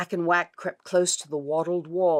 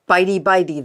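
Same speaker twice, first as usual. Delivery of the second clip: ordinary delivery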